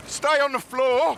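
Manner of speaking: high, slow shouted command; shouting. Real, not acted. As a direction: bellow it to pin him down. Officer restraining struggling suspect.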